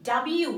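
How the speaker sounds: "ডাব্লিউ" as dab-yu, no L; careful teacher's dab-yu for "ডাব্লিউ"; correct W pronunciation. Shown as a demonstration. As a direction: model "ডাব্লিউ" with a y glide. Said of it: "ডাব্লিউ না".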